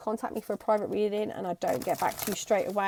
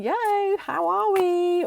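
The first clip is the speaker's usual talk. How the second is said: high, slow cheeky hello; Marty McFly greeting; 10 semitones higher, 6 dB louder, slower than usual. Acted. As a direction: drag out a breezy hello. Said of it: "he's like".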